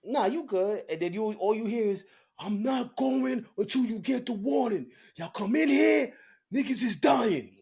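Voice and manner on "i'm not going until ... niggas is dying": high, loud imitation of a raging inmate; retold yelling inmate. Big inmate yelling at guards through cell door, raised high voice. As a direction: yell it like a huge man daring the guards in.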